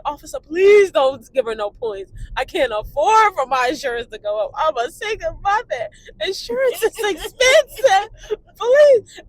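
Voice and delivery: imitating a mother begging, pitch climbing to the last please; hammed-up plea that builds and stretches out; mock tearful pleading. Mother begging the officer off points, mock pleading. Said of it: "pulled over by the cops crying".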